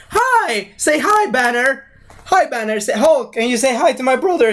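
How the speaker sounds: high, teasing hello; playful Thor greeting. Acted. Thor urging Hulk to greet his brother, playful coaxing.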